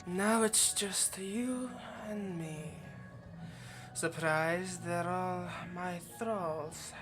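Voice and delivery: very slow, drawn-out gloating; menacing relish. Acted. Vampire alone with trapped hunter, menacing relish. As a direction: stretch each word, toying with the prey.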